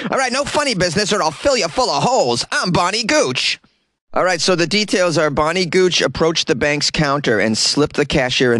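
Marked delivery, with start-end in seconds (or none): 0.0-3.6 high hammed-up gangster granny voice; old gangster lingo. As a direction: threaten like a cartoon mobster. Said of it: "old gangster lingo".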